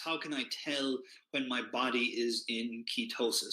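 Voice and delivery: ordinary talk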